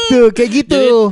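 slow, laughing remark. Real, not acted. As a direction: laugh the words out. Laughing at agreement.

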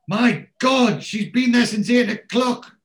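high, loud mock alarm; shocked realisation. Man checking his watch remembers Hazel waiting, loud shock. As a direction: blurt it as the penny drops.

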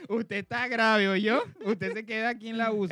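loud, high doctor's verdict; stern doctor. Retold or imitated. Imita al médico espiritual. (médico que declara grave, en voz alta)

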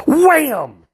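high, sharp wham; mock impact cry. Smell slamming into the trooper in the car, a high startled cry. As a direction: make the hit of a smell land like a blow.

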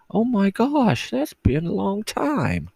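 squeaky high, slow voice; high-pitched silly voice; odd high voice. Real, not acted. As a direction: talk way up high for no reason. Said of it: "Why am I talking like this for?".

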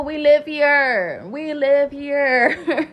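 "live" drawn out slow and loud; slow, loud, stressed "live". Acted. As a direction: lean hard on "live" in "we live here", slowly. Slow emphatic repetition.

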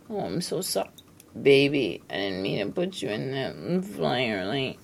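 very soft, tender; tender aside. Real, not acted.

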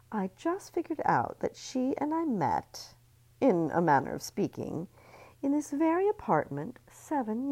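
gleeful sly sweetness; catty socialite. Acted. Missy's reveal.

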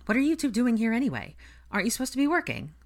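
high, pressing questions; suspicious questioning. A narrator's reading. Ginny questions the visiting twins about work, suspiciously. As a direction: grill them, mildly suspicious and quickening. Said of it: "Ginny said with narrowed eyes".

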